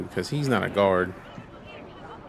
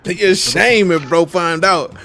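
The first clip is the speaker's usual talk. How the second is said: mock threat; 7 semitones higher, 7 dB louder, slower than usual. Echoing game NPC threat line.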